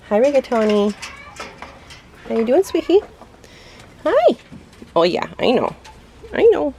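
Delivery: high cooing pet voice. Real, not acted. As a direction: coo at the bird. Cooing to quail.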